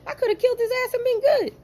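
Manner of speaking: mock outraged. Retelling his angry retort.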